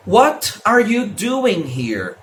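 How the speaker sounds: What are you doing here, each word separate, slow, with a crisp T; textbook pronunciation. Shown as a demonstration. Teacher showing school English, What are you doing here fully articulated. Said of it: "aprende a falar assim".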